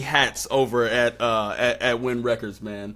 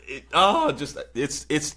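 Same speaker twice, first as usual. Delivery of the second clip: normal talking voice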